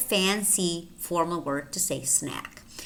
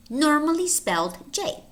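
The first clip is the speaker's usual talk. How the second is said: usual speaking voice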